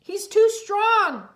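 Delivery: high, loud, slow strained cry; struggling against the pull; straining cry. Acted. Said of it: "Cole cried".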